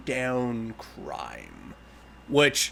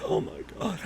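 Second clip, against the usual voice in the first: awed whisper; 5 semitones higher, 11 dB quieter than usual. Real, not acted. Fan awed by the reveal.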